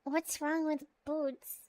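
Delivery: ordinary delivery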